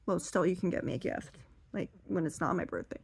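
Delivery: soft, lightly wheedling mother voice; imitated mom. Grandmother asking her daughter for a gift, quietly insistent. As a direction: keep pressing, but quietly. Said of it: "And her mom's like".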